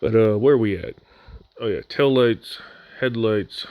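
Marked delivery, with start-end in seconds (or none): none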